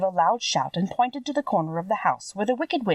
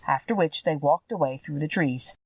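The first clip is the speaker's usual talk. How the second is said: usual speaking voice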